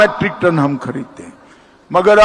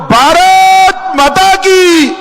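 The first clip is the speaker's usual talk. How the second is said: high, slow thunderous rally call; booming political rally speech; 11 semitones higher, 4 dB louder, much slower than usual. Real, not acted. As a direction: roar it out to lead the crowd. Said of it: "प्रचंड आवाज से बोलो".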